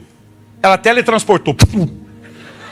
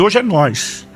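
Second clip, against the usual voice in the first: corner him with calm menace; mock menacing; much slower than usual. Father cornering his son.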